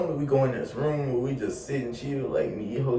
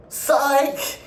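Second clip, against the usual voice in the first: high, loud teasing "Psych!"; spring the joke on the audience; mock gotcha; 6 dB louder than usual. Twist after fake happy ending.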